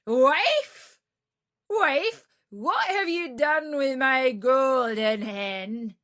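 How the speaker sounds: loud, high shouting giant; furious ogre shouting for his wife; ogre calling out. Acted. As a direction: bellow after the thief. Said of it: "he heard him calling".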